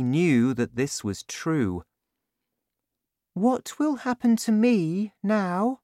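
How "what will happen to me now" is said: put-on much higher girl's voice, puzzled; high worried child; little girl Sophie. A narrator's reading. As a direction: ask like a worried small girl. Sophie asks.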